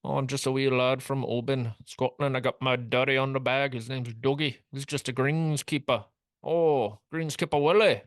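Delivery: Bobby McIntyre. Acted. Podcaster as a golfer introducing his greenskeeper dad, mock Scottish accent. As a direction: ham up a bad Scottish accent, rising to a big finish. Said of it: "but it's Scottish now".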